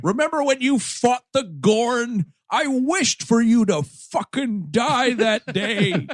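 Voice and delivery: put-on airing an old grudge; mock Scotty grudge. Scotty confessing to Kirk he wished him dead.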